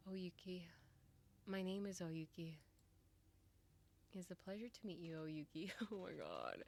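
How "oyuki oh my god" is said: ordinary talk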